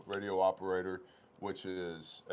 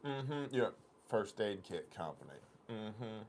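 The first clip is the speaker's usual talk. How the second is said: mild doubting clerk voice; mock skeptical clerk; 4 dB quieter, slower than usual. Clerk doubting the first aid story, mild skepticism. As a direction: repeat the company name back with disbelief. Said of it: "She goes".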